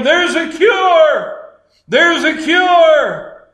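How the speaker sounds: high, loud, drawn-out acted shout; excited yelling. Runner coming into camp with news, high loud yell. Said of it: "and they're yelling".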